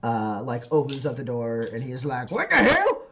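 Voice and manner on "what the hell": high, loud acted alarm; startled shout at a crash scene; shouting man. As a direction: yell in shocked disbelief. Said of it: "He shouts at you".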